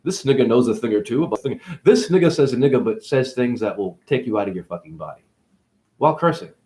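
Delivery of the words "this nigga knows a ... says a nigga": ordinary delivery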